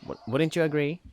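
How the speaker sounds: ordinary delivery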